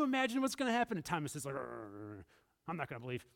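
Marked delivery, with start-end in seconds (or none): 1.5-3.2 low, soft, grumpy growl of refusal; disgruntled grumble. Acted. Thomas refusing to believe his friends, low disgruntled grumble. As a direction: sulk it out low and quiet. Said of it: "He's, he's disgruntled".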